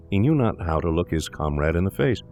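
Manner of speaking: normal talking voice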